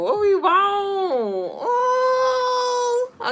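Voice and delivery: high, very slow sing-song wail; playful high cry. Pretend. Streamer playfully wailing while shuffling cards.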